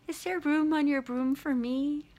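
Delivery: high little bird voice; pleading little bird. Retold or imitated. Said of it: "the bird says".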